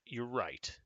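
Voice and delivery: normal talking voice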